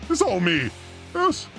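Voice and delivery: ordinary talk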